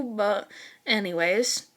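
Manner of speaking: ordinary delivery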